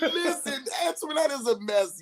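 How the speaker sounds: high laughing call, Antoinette a mess; laughing exclaim. Real, not acted. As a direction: laugh it out, teasing your friend. Laughing exclamation about friend.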